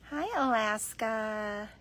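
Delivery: ordinary talk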